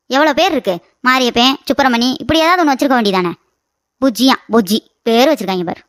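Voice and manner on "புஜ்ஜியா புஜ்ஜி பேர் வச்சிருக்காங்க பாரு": mocking. Aunt mocks the odd name.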